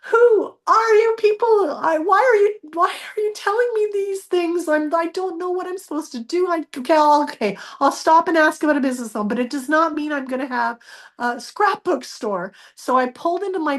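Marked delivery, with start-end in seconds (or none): 0.1-7.2 startled outburst. Retold or imitated. Startled by voice in car. 7.2-9.3 flustered giving in. Retold or imitated. 9.3-12.5 startled outburst. Retold or imitated. Startled by voice in car.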